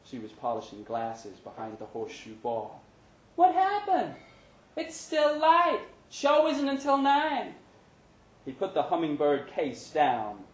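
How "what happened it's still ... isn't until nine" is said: a puzzled barmaid; Brenda, surprised. Acted. Brenda asking Larry why he's early, high and surprised.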